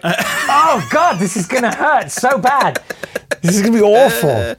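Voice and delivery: drawn-out, high-pitched mock agony; mock dread. Acting out own dread.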